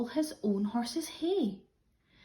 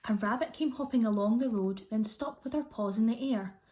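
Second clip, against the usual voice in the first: ordinary delivery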